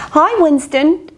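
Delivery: light bark. Acted. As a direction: call out like a cheery dog. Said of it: "barked Angus".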